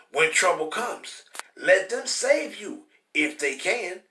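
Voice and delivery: slow, deliberate preaching read; scripture reading. Acted. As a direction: read the verses like a sermon.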